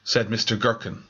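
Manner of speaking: usual speaking voice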